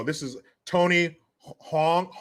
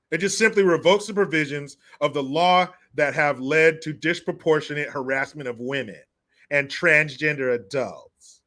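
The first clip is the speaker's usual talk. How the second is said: ordinary talk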